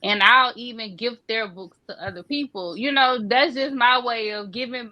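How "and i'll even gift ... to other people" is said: normal talking voice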